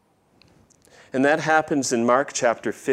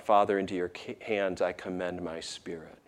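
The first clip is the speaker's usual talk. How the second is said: soft surrender; 4 semitones lower, 8 dB quieter than usual. Retold or imitated. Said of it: "cries out".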